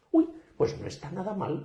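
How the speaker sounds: pleasantly surprised. Retold or imitated. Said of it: "me dabas con ese tono".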